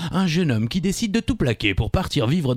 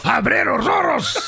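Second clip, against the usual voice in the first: slow, high shouted Spanish with a throaty jota; Spanish accent, rolled jota; 12 semitones higher, 3 dB louder, much slower than usual. Shown as a demonstration. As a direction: drag the Spanish title out with a big jota. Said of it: "vous tenez bien la jota".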